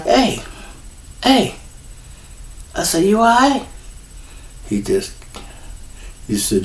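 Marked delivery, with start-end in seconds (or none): 0.0-3.6 soft, high-pitched "hey" to a dazed friend; hushed, lifted voice, checking he's okay; gentle check-in. Retold or imitated. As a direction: quietly try to get his attention. Checking on friend gazing into space.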